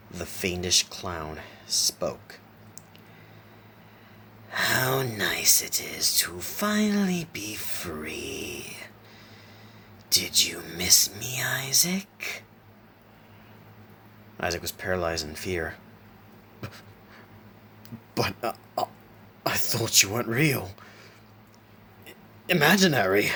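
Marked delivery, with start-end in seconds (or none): 4.5-12.4 slow, whispery, raspy fiendish clown; bone-chilling rasp. A narrator's reading. Clown freed at last greets Isaac, chilling raspy voice. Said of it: "with a bone-chilling, raspy voice". 18.1-23.3 halting, higher voice climbing in disbelief; frightened stutter. A narrator's reading. Isaac paralyzed in fear before the clown, stuttering. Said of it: "Isaac stuttered".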